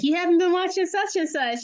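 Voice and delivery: high-pitched mock kid complaint; imitated complaining son. Mother mimicking her son's nagging about unwatched shows, high mock complaint.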